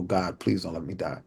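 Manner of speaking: low, hushed retold prayer; quiet plea. Shot boy alone prays to God, quiet plea. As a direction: plead softly, almost to yourself.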